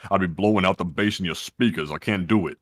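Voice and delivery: very low, speaker-rattling talk; deep bass voice. Shown as a demonstration. Host demonstrating his off-air low, bassy voice. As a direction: drop right down into your bass. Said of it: "I try not to talk like this".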